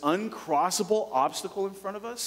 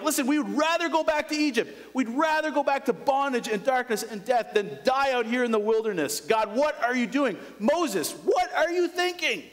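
much higher, frantic mock complaint; hammed-up panic of the people in the desert; the Israelites whining at God and Moses, high and frantic; panicked Israelites; 9 semitones higher than usual. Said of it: "They're like".

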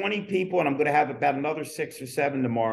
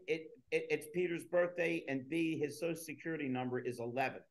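quiet aside; 12 dB quieter than usual. Real, not acted. Deadpan joke said under breath.

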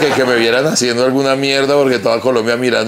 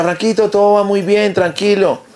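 high, sweet reassuring woman's voice; light falsetto impression of a reassuring woman; voicing Lenia; 11 semitones higher than usual. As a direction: mimic a calm teammate soothing him.

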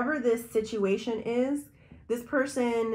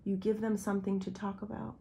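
much softer, almost to herself; soft repeat; 7 dB quieter than usual. Real, not acted. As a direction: let it sink in quietly. Quiet repeat of previous line.

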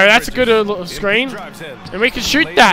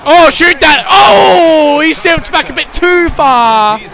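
excited yell; 6 semitones higher, 5 dB louder, slower than usual. Real, not acted. Big shot in video game.

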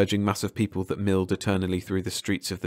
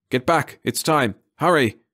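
louder, higher urgent shout; sudden warning; 6 semitones higher, 4 dB louder than usual. A narrator's reading. Eric warning Jan and Mara before the blast, shouting. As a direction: shout the warning to get clear.

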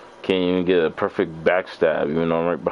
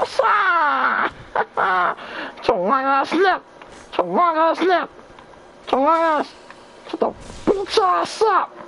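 much higher, shouted trash talk; triumphant yell; slower than usual. Real, not acted. Gamer gloats over a beaten foe, high triumphant yelling. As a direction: crow over the beaten enemy. Wins the fight, trash-talks.

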